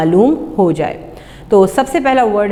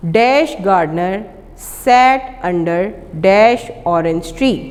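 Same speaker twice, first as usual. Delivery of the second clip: slow dictation; much slower than usual. Shown as a demonstration. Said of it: "later के बाद comma और कॉमे के बाद डैश है".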